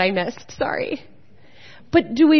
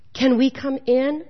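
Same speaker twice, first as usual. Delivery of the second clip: ordinary delivery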